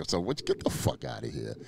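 dismissive scoff. Retold or imitated. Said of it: "when I was sounding like".

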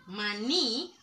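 maní with the final ní held; maní, second syllable drawn out; stress on NÍ. Shown as a demonstration. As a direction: lean on the end of maní. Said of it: "lleva tilde en la I".

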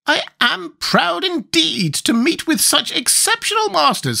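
high, slow squeaky creature, offhand; careless Gump. A narrator's reading. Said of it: "in a careless tone".

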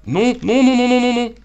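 high, loud string of no's; panicked shouting. Real, not acted. Panique devant le danger en jeu. (cri de panique, aigu et fort)

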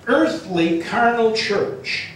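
ordinary talk, nothing marked

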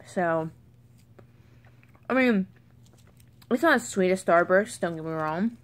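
chewing while talking; speaking around chewy candy; mouth full of candy. Real, not acted. Seen 2: jaw working, mouth full, candy wrapper visible throughout.

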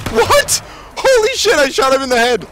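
high-pitched, loud yell of disbelief; shocked shout. Real, not acted. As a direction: shriek it out, stunned by what happened. Shock at failed kill in game.

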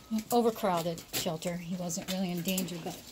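ordinary talk

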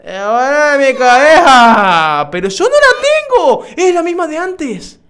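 high, loud, slow indignant lines; outraged character voice. Acted. Gamer voicing the game character's angry retort, loud and high. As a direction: read it like an offended ghost girl.

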